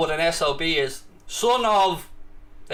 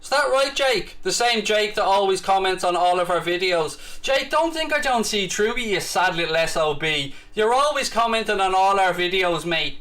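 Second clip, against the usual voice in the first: usual speaking voice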